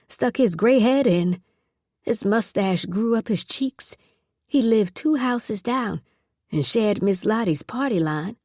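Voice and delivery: usual speaking voice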